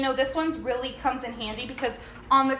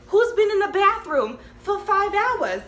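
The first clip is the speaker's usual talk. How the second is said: higher, louder exasperated quote; mock outcry; 8 semitones higher, 5 dB louder than usual. Family calling out about the occupied bathroom, mock outcry. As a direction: call it out like an impatient family. Acted exasperated group shout.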